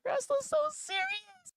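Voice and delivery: very high squeaky mock viewer voice; whiny caricature of a hurt fan. To viewers, parodying fans who call him serious, squeaky mock whine.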